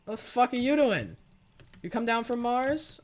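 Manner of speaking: loud, high-pitched disbelief; incredulous outburst. Real, not acted. Gamer to an opponent who came down from Mars, incredulous.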